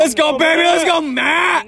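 cheering shout. Real, not acted. Cheering teammate from dugout.

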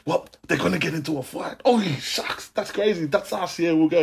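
high, giddy schoolkid voice; kid hyped for a fight. Retold or imitated. Schoolkid to classmates, high and giddy. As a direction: squeal it like a kid hearing gossip. Kids excited about a fight.

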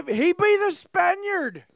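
very high, loud, slow pirate cry; pirate accent. Acted. Gamer naming a friend as crewmate, pirate accent. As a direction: belt it out as a hammy pirate. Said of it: "I always do a pirate accent".

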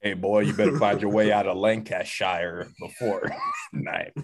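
shouting Southern sheriff, laughing at the end; mock Southern threat. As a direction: bark it like a small-town sheriff. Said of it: "a Southern accent".

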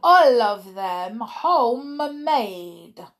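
put-on slow, loud, sly accented gloat; fiendish leer. A narrator's reading. Grand High Witch joking to witches, slow leering gloat. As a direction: leer through the joke. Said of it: "with a fiendish leer".

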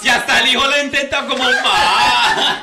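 loud high mock prince voice; whiny impatient son. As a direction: shout it like a spoiled heir. Son pleading with old queen.

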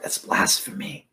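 low, voiceless whisper of scandal; imitating someone scandalized under their breath; muttered inner outrage. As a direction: whisper it as a shocked private thought. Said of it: "saying in their heart".

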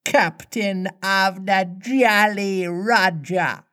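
mimicked pirate voice, stately and stretched; Hook. A narrator's reading. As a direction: announce yourself as the captain, drawn out. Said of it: "replied the voice".

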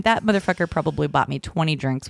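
normal talking voice

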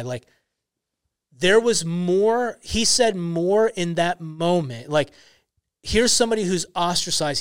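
normal talking voice

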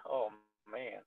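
ordinary talk

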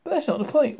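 high, loud protest; raging. A narrator's reading. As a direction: burst out in angry objection. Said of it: "raged Ford".

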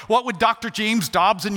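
ordinary delivery

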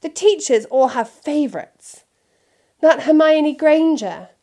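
put-on a spoiled boy blaming others; whiny Draco. A narrator's reading. Draco complaining to his father, petulant. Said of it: "retorted Draco".

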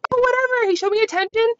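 high, giddy imitation of her younger self; mock the girl who said yes to anyone; airheaded old self. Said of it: "I used to just be like".

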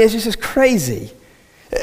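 usual speaking voice

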